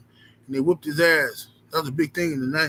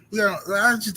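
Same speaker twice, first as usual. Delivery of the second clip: ordinary delivery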